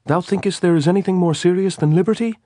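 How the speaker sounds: high, loud challenging question. A narrator's reading. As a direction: demand an answer. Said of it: "he asked challengingly".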